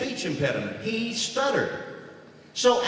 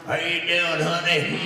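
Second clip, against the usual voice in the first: lightly voiced slow grunting drawl; a hint of the Sling Blade hum. Husband greeting his wife in the Sling Blade voice. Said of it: "Sling Blade".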